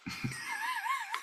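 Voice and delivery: sudden high-pitched giggle, held; a brief squeal of a laugh; high squeaky laugh. Real, not acted. Host cracks up at his Jeff joke, squeaky laugh.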